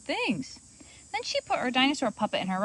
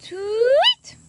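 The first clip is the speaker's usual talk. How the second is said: high, loud sweet tweet; sweet bird tweet; 13 semitones higher, 9 dB louder than usual. A narrator's reading. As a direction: chirp it sweetly like a little bird. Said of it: "the bird answered sweetly".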